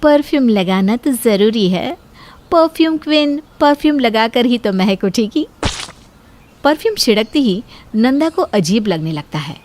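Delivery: ordinary delivery, nothing marked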